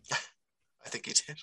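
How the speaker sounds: breathy laugh through the words. Real, not acted. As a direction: chuckle it out. Laughs at Tiger Toffoli joke.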